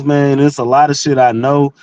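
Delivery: ordinary delivery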